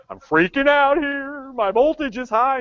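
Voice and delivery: high, loud, slow fretful gadget voice; panicking hot tub. Pretend. Hot tub texting its repair woman, high loud panic. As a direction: play the hot tub in a fluster.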